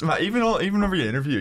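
ordinary talk, nothing marked

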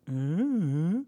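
a mock ghostly drawn-out uh; lightly voiced spooky groan; imitating ghost tone. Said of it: "it's kind of that tone".

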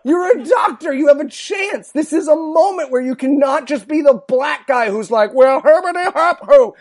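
high, loud, incredulous yell; retold yelling at TV. Yelling at the doctor on TV, high and loud, laughing. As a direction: yell at the screen in disbelief. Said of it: "And I was just like".

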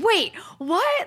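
high, far-rising startled question; retold stunned reaction; acted astonishment. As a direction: shoot the pitch up in mock disbelief. Acting friend's startled reaction.

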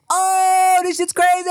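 imitating a stunned reaction; shocked exclamation. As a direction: gasp it out like the twist just hit.

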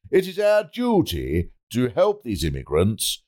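measured oratory with weighty pauses; pompous politician's speech. Acted. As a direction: declaim it like a minister addressing the nation. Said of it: "politicians make those speeches that go".

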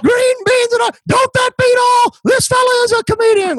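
very high, loud hillbilly voice. Retold or imitated. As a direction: cackle at the city comic like a farmer. Farmer mocks comedian.

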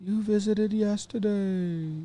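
high, soft, hammed-up ghost moan; spooky ghost voice. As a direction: play a silly ghost, hooting softly. Ghost game continues.

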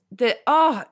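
ordinary delivery

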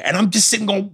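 ordinary talk, nothing marked

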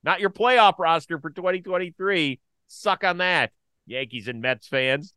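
loud, high gloating jab; rubbing it in to Yankees and Mets fans; taunting. Real, not acted. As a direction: needle the rival fans.

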